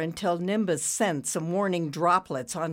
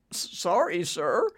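stammered apology; 2 semitones higher than usual. A narrator's reading. Humiliated worker apologizes to boss.

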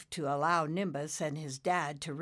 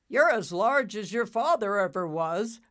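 loud, higher bull-cloud voice; lightly voiced bull cloud; 5 semitones higher, 8 dB louder than usual. Bull-shaped cloud praising Nimbus, loud. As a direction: speak up as a big bull of a cloud.

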